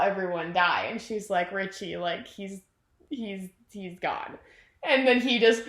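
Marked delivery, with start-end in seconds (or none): 1.5-4.4 soft, halting, slowing down; acted gentle bad news. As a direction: break it softly, faltering on the words. Bev gently breaking bad news.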